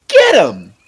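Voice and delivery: loud, gleeful shout; merry shout. Acted. Boy on Dunk's shoulders cheering a knight, gleeful shout. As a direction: egg the knight on with glee. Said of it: "Egg shouted merrily".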